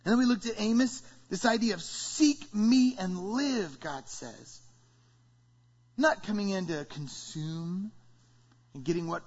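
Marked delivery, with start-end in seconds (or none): 1.8-3.8 slow quote. Acted. Quoting God, slowed emphatically.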